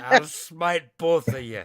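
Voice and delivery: loud, slow, laughing threat; booming threat laughing. Acted. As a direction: boom it out while laughing. Player voicing a gargoyle threat.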